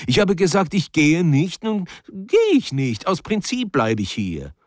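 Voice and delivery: high, emphatic, indignant refusal; stubborn refusal. Acted. Student refusing on principle to leave, stubbornly indignant. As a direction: dig in, pitched high and insistent. Mitja refuses in acted quarrel.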